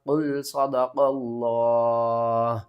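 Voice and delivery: very slow, drawn-out recited phrase; recitation pause. Shown as a demonstration. Teacher demonstrates the waqf to students, slow recitation. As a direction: chant it slowly as at a waqf. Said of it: "کس طرح؟".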